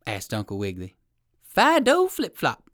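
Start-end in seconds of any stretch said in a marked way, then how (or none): 1.4-2.7 high, loud, slow announcement; little poodle voice. A narrator's reading. Poodle giving his name to the rabbit, proudly announcing. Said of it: "was the answer".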